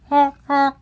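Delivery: two high, short goose honks. Acted. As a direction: honk like a goose. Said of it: "The goose says".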